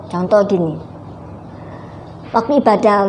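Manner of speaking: ordinary delivery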